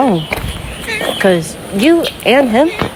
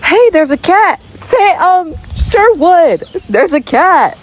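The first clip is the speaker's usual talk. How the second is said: high excited call; 8 semitones higher, slower than usual. Real, not acted. Spotting a cat, calling out.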